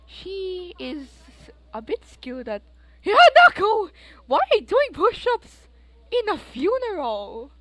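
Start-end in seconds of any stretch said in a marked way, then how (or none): 3.0-7.5 high, loud yell at the sim; scolding shout at Hanako; shocked shout. Real, not acted. Player yells at sim mid-funeral.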